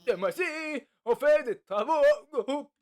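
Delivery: voix de prof plus haute et forte, lente. Acted. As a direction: annonce les groupes comme un prof. Prof annonce travaux de groupe. (voix de prof aiguë qui annonce le travail en groupe)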